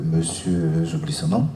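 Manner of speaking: normal talking voice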